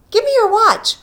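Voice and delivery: very high, loud and eager; high, loud eager request. A narrator's reading. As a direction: she can hardly wait. Eager demand for the watch.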